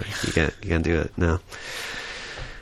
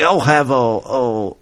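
put-on playful stab at a British accent; attempted British accent; much slower than usual. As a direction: imitate a British diner ordering. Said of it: "That sounds just like him".

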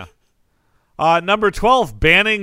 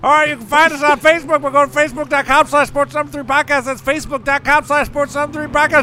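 mouth full; 8 semitones higher than usual. Pretend. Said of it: "I'm eating French fries while I'm reading this".

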